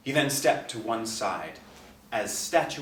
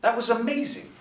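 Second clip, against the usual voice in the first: put-on much higher, excited teenage girl's cry; thrilled daughter exclaims to her father, high and excited; astounded exclaim; 13 semitones higher, 5 dB louder than usual. A narrator's reading. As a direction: burst out in amazement to her dad. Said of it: "the astounded teenager exclaimed".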